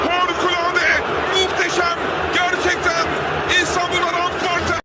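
high, thrilled commentary; excited praise. Real, not acted. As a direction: rave about the goal. Praise after a goal. (coşkulu övgü)

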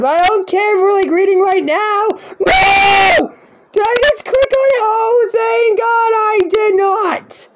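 high-pitched frantic shouting; raging. Real, not acted. Player refusing to read right now, raging. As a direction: shout in a real gaming rage. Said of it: "I was raging like that".

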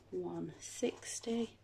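soft whispered total, slow; breathy aside under her breath. Real, not acted. Muttering total to herself.

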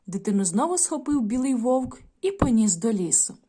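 put-on storytelling voice for young pupils; story narration. A narrator's reading. Teacher reading a folk tale to the class, gently. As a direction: read the tale aloud to children.

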